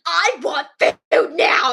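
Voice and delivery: slow, loud mock tantrum; demanding screaming girl. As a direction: imitate a child yelling demands, each word stretched. Retells girl screaming at leaders.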